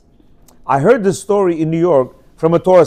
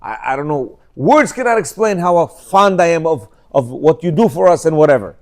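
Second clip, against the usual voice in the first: tender compliments; 5 semitones lower than usual. Shown as a demonstration. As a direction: murmur your fond thanks gently. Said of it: "And then say".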